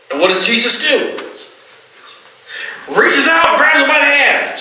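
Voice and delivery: ordinary talk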